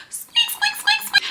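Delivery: tiny high squeaks; mouse squeaking. Pretend. Repeats animal sounds.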